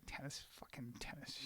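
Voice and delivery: imitating a disgruntled whisper; muttered curse. Struck passenger grumbling about a tennis racket, whispered mutter. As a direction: curse under your breath, almost voiceless.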